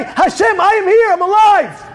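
high, loud cry to God; high-pitched impassioned proclamation; fervent declaration. Acted. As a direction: shout it up to heaven. Preacher acts out answering God.

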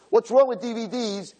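put-on high, whiny teenage pushback; defiant teen daughter. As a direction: whine it like a sulky teen. Daughter talks back to mother.

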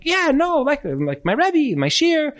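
squeaky high, flustered dodge; evasive dodge. Retold or imitated. Husband squirming to avoid talking about his job, high and flustered. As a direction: slip away from the question up high. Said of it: "And he's like".

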